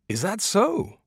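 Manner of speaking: marvelling at the news; impressed surprise. A narrator's reading. Barba Stavro hearing of the olive comb, amazed. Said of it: "as impressed as though he'd learned".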